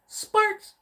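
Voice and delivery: Sparks! squealed very high and loud; squeaky cry. Pretend. To chat, showing his idea sparks, squealing very high. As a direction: yelp it in a tiny cartoon squeak.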